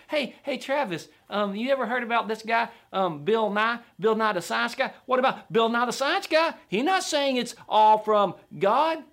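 high, insistent mock sceptic; challenging sceptic. Sceptic pressing the preacher with Bill Nye, mock challenge. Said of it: "here's one".

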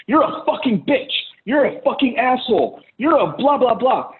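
high-pitched mock insults; angry insult. Example of cursing at spouse.

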